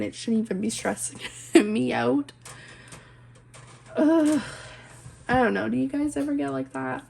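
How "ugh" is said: a higher, louder exasperated ugh; frustrated groan. Real, not acted. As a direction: groan at yourself for being silly. Frustrated about stressing needlessly.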